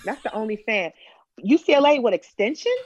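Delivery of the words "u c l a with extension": high-pitched skeptical question; mock-skeptical rising query; imitated doubt. Imitating doubters questioning her enrollment.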